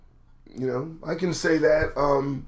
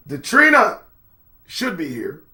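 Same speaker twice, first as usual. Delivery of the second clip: normal talking voice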